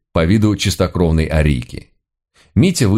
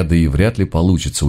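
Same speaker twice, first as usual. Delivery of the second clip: plain reading voice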